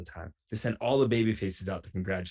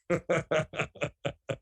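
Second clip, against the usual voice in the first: laughing at own story; a real, higher-pitched laugh; 6 semitones higher than usual. Real, not acted. Laughs after telling the story.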